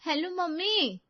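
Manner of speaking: high-pitched, loud hello to mom; daughter-in-law talking up loudly on a call; loud phone talk. A narrator's reading. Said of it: "जोर-जोर से बातें करने लगती है".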